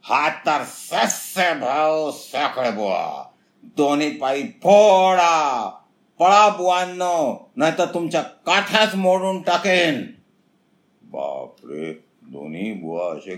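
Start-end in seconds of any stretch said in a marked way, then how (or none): 0.0-10.0 slow, high, very loud threatening bellow; loud fierce shout. Acted. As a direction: roar the threat to scare them off. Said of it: "जोराने ओरडला".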